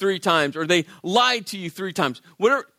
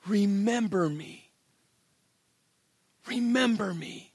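quiet plea; 6 dB quieter than usual. Retold or imitated. Dying thief pleading with Jesus, soft and quiet. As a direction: beg him gently, barely voiced.

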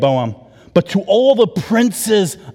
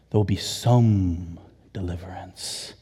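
hushed whisper; 9 semitones lower, 6 dB quieter than usual. Acted. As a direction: breathe the promise softly. Whispered quote of God.

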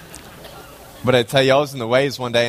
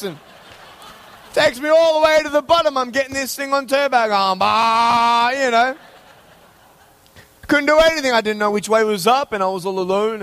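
excited retelling; 11 semitones higher than usual. Retold or imitated. Telling the church about the wave, excited and high. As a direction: relive the wipeout at high speed.

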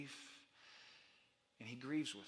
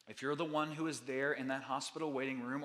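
normal talking voice

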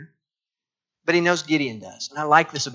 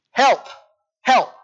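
mock cry for help; 9 semitones higher than usual. Preacher voicing Peter sinking, mock plea for help. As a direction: call help like a sinking man, half laughing.

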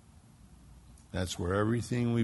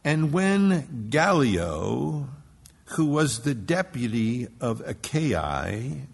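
slow emphatic reading; 3 semitones higher, 5 dB louder, much slower than usual. Real, not acted.

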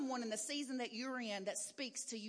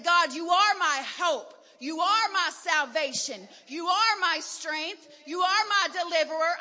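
loud, higher, fervent praise; praising God; 3 semitones higher, 9 dB louder than usual. Retold or imitated. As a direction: lift it up like a cry of worship. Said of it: "And I started praising God".

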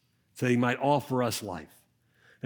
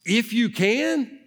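slow, much higher and louder, echoing in disbelief; incredulous Jesus; 9 semitones higher, 4 dB louder, much slower than usual. Acted. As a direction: stretch "If you can?" as if amazed anyone would doubt. Jesus repeating father's words.

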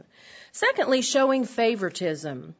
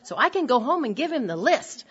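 rising to a high, loud mock protest; mock indignant wife; 5 semitones higher, 5 dB louder than usual. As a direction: play the listener demanding a husband list. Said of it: "you might be saying".

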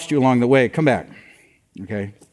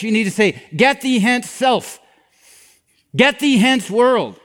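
higher-pitched, emphatic sermon delivery; emphatic preaching; 6 semitones higher than usual. Acted. Pastor warning the congregation about temptation, emphatic preaching. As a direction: drive each warning home.

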